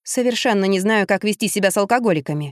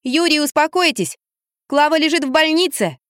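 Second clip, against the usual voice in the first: higher voice projected upstairs; calling out; 7 semitones higher than usual. A narrator's reading. Woman calls up to a drunk man from the stairs, shouting. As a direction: call up the stairs, pitched high. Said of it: "подошла к лестнице и крикнула".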